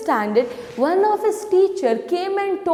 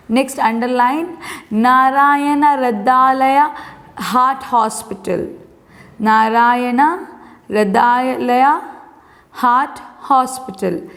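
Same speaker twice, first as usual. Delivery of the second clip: repeat-after-me reading; 3 semitones lower than usual. Shown as a demonstration. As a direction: read out word by word for pupils to repeat, pausing after each phrase.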